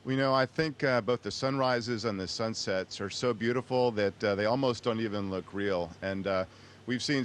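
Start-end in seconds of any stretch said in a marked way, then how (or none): none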